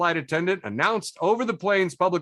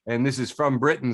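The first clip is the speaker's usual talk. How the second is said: normal talking voice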